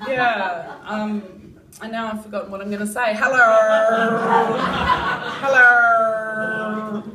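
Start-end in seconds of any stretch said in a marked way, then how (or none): 3.1-7.0 loud call; calling hello. Real, not acted. Calling out to someone, loud. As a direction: call out to get attention.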